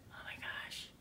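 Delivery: whispered, barely any voice; awed whisper. Retold or imitated. Answering people who call her joyful, awed whisper. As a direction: whisper it in quiet amazement.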